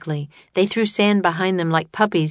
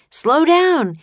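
high, loud yell; desperate yell; 11 semitones higher, 7 dB louder than usual. A narrator's reading. Jack on his bolting camel, desperate yell. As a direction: yell it out high, pleading to be obeyed. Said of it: "he yelled".

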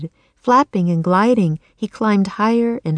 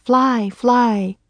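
higher-pitched, lightly urging call; 4 semitones higher than usual. A narrator's reading. Annie urging Jack into the air, lightly calling out. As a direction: call it out a little high, urging. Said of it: "she called".

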